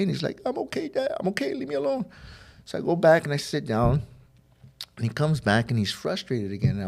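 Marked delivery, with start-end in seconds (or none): 0.5-2.1 put-on very high, upset kid voice; imitated tearful child. Son crying in the bathroom to his dad, mock tearful protest. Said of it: "I can hear him crying in the bathroom".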